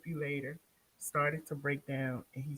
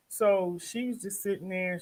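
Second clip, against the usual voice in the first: ordinary talk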